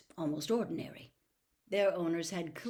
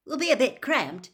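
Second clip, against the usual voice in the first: louder and higher, called from inside a tent; calling out; 6 semitones higher, 9 dB louder than usual. A narrator's reading. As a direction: call out to the kids outside. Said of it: "he called".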